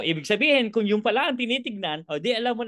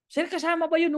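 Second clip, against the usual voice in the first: lightly voiced eager attendee, pitched up high; high questioning prospect voice; 10 semitones higher than usual. Attendee asking the speaker, high and curious. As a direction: play the attendee asking sir in a raised voice.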